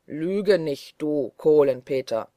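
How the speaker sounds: loud booming threat; thundering voice. A narrator's reading. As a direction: thunder it at him like a forest giant. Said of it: "mit donnernder Stimme".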